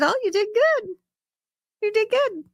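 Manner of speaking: high, gentle cooing praise; sweet mock praise. Miss Ada praising the boy's singing, high and cooing. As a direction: coo it like to a small child. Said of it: "And I said".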